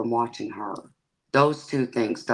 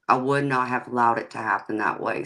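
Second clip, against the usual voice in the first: ordinary delivery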